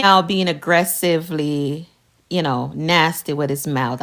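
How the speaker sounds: normal talking voice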